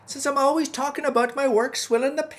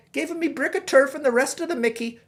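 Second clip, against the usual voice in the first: ordinary talk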